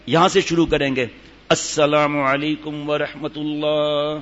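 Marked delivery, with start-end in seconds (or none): 1.5-4.2 slow, drawn-out salam of prayer; slow salam demo. Shown as a demonstration. Teacher showing students the salam, slow and drawn out. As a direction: stretch each word as a teacher shows the salam. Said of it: "سلام پھیرنے کا کیا طریقہ ہے".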